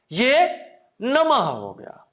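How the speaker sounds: नमः drawn out slowly with a ha ending; slow, deliberate नमः with the visarga heard; slow emphasis. Shown as a demonstration. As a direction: spell out नमः slowly for students. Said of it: "इसको आपको ध्यान देना है".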